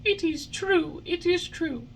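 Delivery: put-on high tearful puppet boy; admitting it while weeping; tearful Pinocchio. A narrator's reading. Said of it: "cried Pinocchio in tears".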